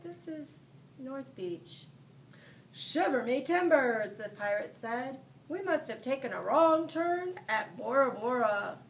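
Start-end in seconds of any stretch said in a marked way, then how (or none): 0.0-1.9 put-on soft and slow little-boy answer; young boy telling the pirate, softly; a boy's small voice. A narrator's reading. 2.7-4.2 loud gruff pirate exclamation; hammed-up pirate bluster; captain surprised by the beach, loud. A narrator's reading. 5.5-8.8 loud pirate musing; captain explaining the wrong turn, pirate voice. A narrator's reading.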